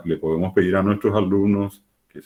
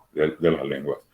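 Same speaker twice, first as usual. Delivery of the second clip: usual speaking voice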